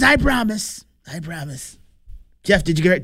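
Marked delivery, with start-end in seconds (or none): 0.0-1.8 higher first promise, then dropping much softer; lightly voiced Trump promising; Trump impression. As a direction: mimic the president swearing it. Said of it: "in his words".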